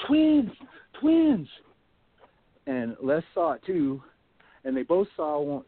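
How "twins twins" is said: much higher and louder frightened call; alarmed cry. Retold or imitated. Michael running back from the bushes, high frightened shout. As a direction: yell the warning while stumbling backwards.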